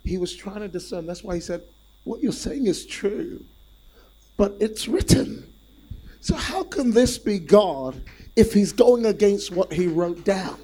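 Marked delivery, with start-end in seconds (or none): 2.0-10.6 rising preaching intensity. Acted. Jesus answering Satan, preacher builds.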